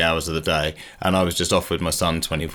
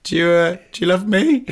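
high, tentative pleading question; needy; 9 semitones higher than usual. Retold or imitated. Dad to sleepy son in the dark, needy. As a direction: lift it hopefully, fishing for love. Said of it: "I'm really needy".

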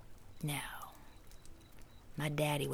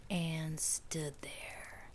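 fading whisper; 9 dB quieter, much slower than usual. A narrator's reading. Repeated phrase, fading away.

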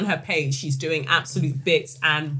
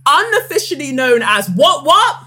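rising louder to a shout; hype build-up; 7 semitones higher, 8 dB louder than usual. Shown as a demonstration. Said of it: "rewind selector".

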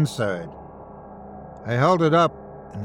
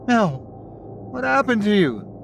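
cried out; 8 semitones higher than usual. A narrator's reading. Father seeing his changed daughter, crying out high. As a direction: cry out her name in shock. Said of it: "he cried".

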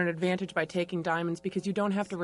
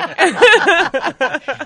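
loud laugh; 10 semitones higher, 17 dB louder than usual. Real, not acted. Guests laugh at tipping question.

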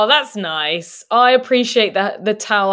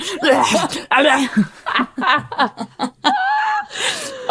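long laugh; 8 semitones higher than usual. Real, not acted. Long laugh after bit.

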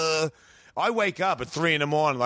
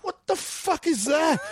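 shocked disbelief; 12 semitones higher, slower than usual. Retold or imitated. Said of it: "and I'm like".

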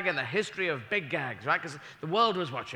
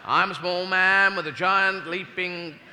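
botched line; 4 dB louder, slower than usual. Retold or imitated. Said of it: "I'll do it again".

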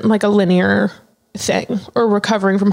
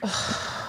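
shaky, breathy sigh; 14 dB quieter than usual. Real, not acted. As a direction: let out a trembling breath. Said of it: "You can cry".

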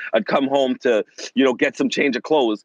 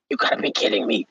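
stunned whisper; 8 semitones lower, faster than usual. Retold or imitated. Disbelief at dad's kindness.

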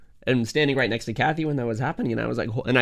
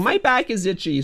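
put-on toddler voice; 8 semitones higher, slower than usual. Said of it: "and then he's like".

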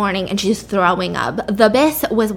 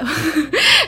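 higher-pitched burst of laughter; real laugh; 6 semitones higher than usual. Real, not acted. Storyteller amused by her drunk heroine, real laughing. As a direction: crack up at your own impression. Cracks up after own acted line.

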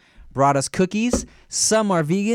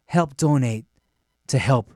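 mock old PSA read, slightly lowered; old commercial announcer; 4 semitones lower than usual. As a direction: pause solemnly between phrases. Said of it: "there's one where it's just like".

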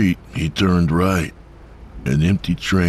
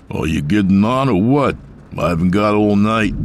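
raised, higher, irritated call; loud exasperated demand; impatient driver; 5 semitones higher, 4 dB louder than usual. A narrator's reading. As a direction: hurry them up loudly, losing patience. Impatient driver calls to man outside.

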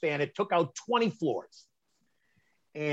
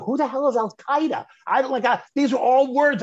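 baffled outburst; 10 semitones higher, 5 dB louder than usual. Real, not acted. Baffled by Al Qaeda.

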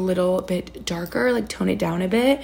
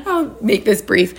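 nervous laugh; 2 semitones higher than usual. Real, not acted. Embarrassed about hair topic.

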